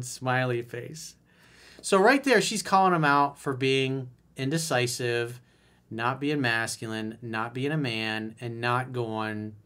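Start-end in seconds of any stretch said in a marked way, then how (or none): none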